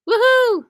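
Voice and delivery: high, loud "woohoo" cheer; excited whoop. Real, not acted. Shop host cheering the sale deals, excited whoop.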